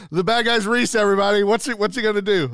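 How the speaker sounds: loud, high, announcing to everyone; excited announcer call. Retold or imitated. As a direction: call it out to the whole set.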